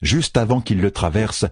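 ordinary delivery, nothing marked

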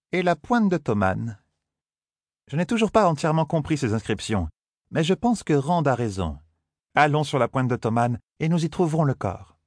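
lightly voiced stern Aes Sedai. A narrator's reading. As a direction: speak as a calm, knowing sorceress correcting a boy. Verrine voiced by narrator.

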